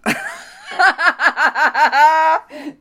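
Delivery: high helpless cackle; real giggle fit; loud laughter bursts out. Real, not acted. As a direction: laugh explodes again. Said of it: "Jesus, stop it".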